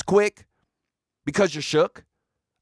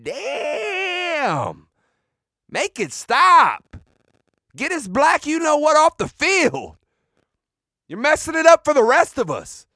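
high, drawn-out pleading yell; exasperated outcry; 9 semitones higher, 4 dB louder, slower than usual. Retold or imitated. Fan watching the game, crying out in mock despair. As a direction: cry out in comic agony. Acting out his reaction while watching.

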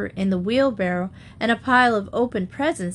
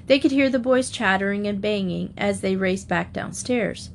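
plain reading voice

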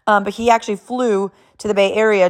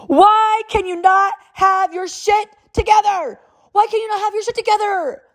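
losing it; 14 semitones higher than usual. Retold or imitated. Mom snaps at youngest son over forgotten bag, high exasperated yell. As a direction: reenact snapping at your kid, voice shooting way up. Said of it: "I just kinda lost it".

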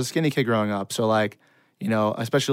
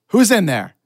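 sharp, raised teacher's challenge; calling out; 8 semitones higher, 7 dB louder than usual. Acted. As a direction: call it through the door, high and loud. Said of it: "a voice getting closer".

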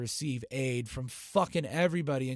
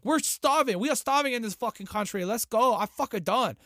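much higher, loud starving cry; mock desperate yell; 9 semitones higher, 6 dB louder than usual. North Koreans voiced quitting their republic, loud desperate yell. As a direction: wail it like a fed-up starving crowd. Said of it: "be like".

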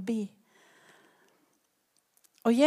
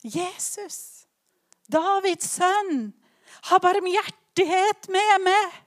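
higher, louder, slow desperate plea; desperate cry; 7 semitones higher, 5 dB louder, slower than usual. Retold or imitated. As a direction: beg for mercy, slow and loud. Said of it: "han roper". (fortvilet rop, sakte og høyt)

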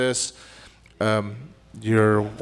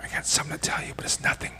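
soft unvoiced whisper; sweet nothings whisper; 6 dB quieter, much faster than usual. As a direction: whisper it close to her ear. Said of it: "Whisper sweet nothings in my ears".

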